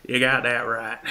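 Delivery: loud, put-on distant yell; yelling from upstairs. Unseen character calling from the top of the stairs, yelling. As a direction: holler it down the stairs. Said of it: "you can hear yelling from the top of the stairs".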